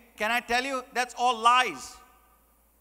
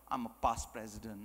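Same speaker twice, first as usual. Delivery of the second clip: self-important past president; 13 semitones lower, 12 dB quieter than usual. Acted. Club past president excusing himself from helping, self-important. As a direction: intone it low and grandly. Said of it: "Emphasis on past".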